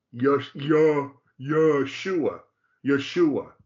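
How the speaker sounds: YESHUA as yuh-SHOO-uh, first vowel barely said; their pronunciation. Shown as a demonstration. Teacher showing how they say it, YESHUA as yuh-SHOO-uh. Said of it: "as they say it".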